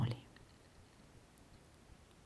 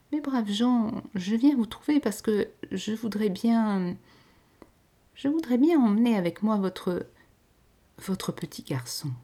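put-on young lady, higher and halting; trembling broken voice; 5 semitones higher, 3 dB louder than usual. A narrator's reading. Lady pleading with the peasants, halting and higher. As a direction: falter through the plea, broken by nerves. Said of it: "d'une voix entrecoupée, tremblante".

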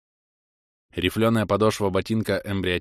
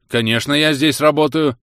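indignant outburst, louder; 5 semitones higher, 7 dB louder than usual. A narrator's reading. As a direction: bristle at the insult. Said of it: "возмутился техник". (возмущённо, громче)

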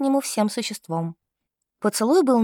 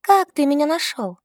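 Liza asking; 5 semitones higher than usual. A narrator's reading. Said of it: "Лиза спросила".

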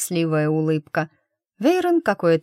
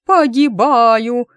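high, loud, drawn-out wail; yelling in a silly voice; 6 semitones higher, 8 dB louder, much slower than usual. Acted. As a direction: bawl it in a ridiculous voice.

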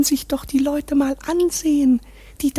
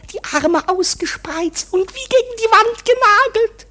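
excited; 6 semitones higher, 5 dB louder than usual. Acted. Gloating over imagined murder.

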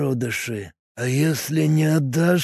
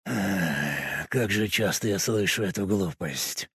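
tired, sighing, much softer; weary sigh; 5 semitones lower, 14 dB quieter, slower than usual. A narrator's reading. Cultist wearily dismissing the slavery question, tired sigh. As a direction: sigh it out, worn down by the old question. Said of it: "устало вздохнул Каек".

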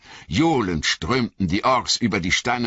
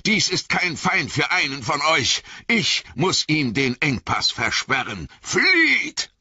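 Gandalf shouting; 9 semitones higher than usual. Acted. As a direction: order them to run. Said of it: "rief Gandalf".

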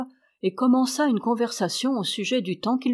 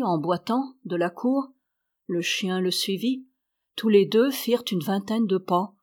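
usual speaking voice

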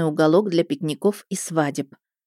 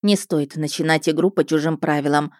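plain reading voice

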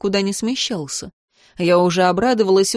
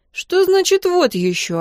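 high, slow, affronted question; offended; 10 semitones higher than usual. A narrator's reading. Girl takes offence at Vadim's remark, offended. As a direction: throw it back at him, hurt and indignant. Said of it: "обиделась я".

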